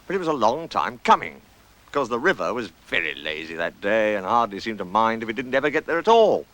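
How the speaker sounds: ordinary delivery, nothing marked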